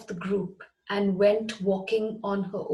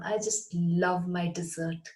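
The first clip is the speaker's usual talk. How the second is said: ordinary talk, nothing marked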